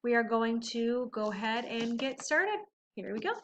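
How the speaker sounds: plain reading voice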